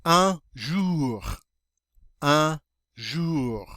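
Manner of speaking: "Un jour" with oo vowel and French r; teacher modeling "Un jour" for the class; model French word. Shown as a demonstration. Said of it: "That O U is always pronounced like oo".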